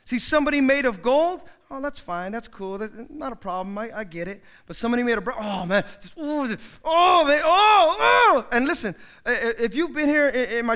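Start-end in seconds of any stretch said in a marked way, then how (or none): 1.7-4.4 soft, unbothered reassurance; calm easygoing. Acted. Calm gold person. 5.4-8.5 high, loud crying groan; hammed-up heartbroken moaning; mock anguished wail. Brass person falls apart.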